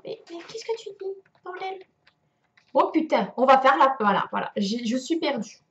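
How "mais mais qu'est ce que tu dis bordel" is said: small, high, soft voice; hushed, high voice for a baffled cat; voicing the cat. Player voices her staring cat, softly bewildered. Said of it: "Il fait genre".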